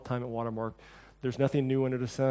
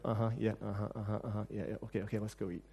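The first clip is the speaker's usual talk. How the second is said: bored mumbling; 7 semitones lower, 11 dB quieter, much faster than usual. Retold or imitated. Listeners brushing off the sermon.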